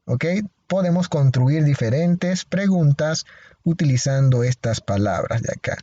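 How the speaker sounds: ordinary talk, nothing marked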